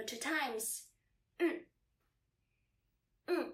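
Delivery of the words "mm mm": "Mm", very short and fast, a pause after each; quick, cut-short "Mm" for repeating; short fifth tone. Shown as a demonstration. Teacher asking learners to repeat after her, short and fast. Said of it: "fifth tone is quite short and fast".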